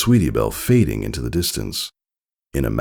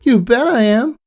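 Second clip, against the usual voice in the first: high eager filly voice; 5 dB louder than usual. A narrator's reading. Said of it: "Scootaloo replied".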